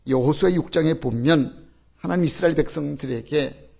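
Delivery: ordinary talk, nothing marked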